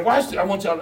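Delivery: ordinary talk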